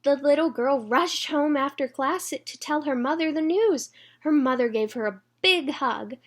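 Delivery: put-on bright read-aloud for children; storybook reading. A narrator's reading.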